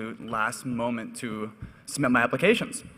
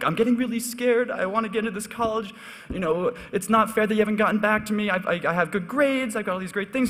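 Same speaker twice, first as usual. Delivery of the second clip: much higher, pleading, on the verge of tears; phoning the dean, playing the scared kid with a higher, pleading voice; mock choked-up plea; 8 semitones higher than usual. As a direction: fake tearful panic to win sympathy. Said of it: "almost kinda choking up".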